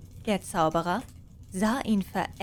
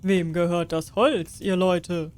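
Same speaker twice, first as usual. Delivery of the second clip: regal question, a touch louder; lightly voiced king; 2 semitones lower, 3 dB louder than usual. King asks the peasants about the hay, a hint regal.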